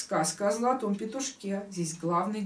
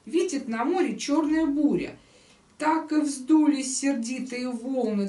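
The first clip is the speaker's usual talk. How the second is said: raised, emphatic storyteller's verse; expressive tale reading; 4 semitones higher, 4 dB louder than usual. Acted. Teacher reading Pushkin's fish tale aloud, expressive storytelling. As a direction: declaim the tale with weight on each beat.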